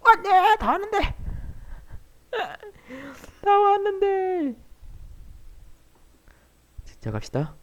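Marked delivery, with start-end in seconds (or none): 0.1-4.6 dismayed cry. Real, not acted. Fails right at the goal.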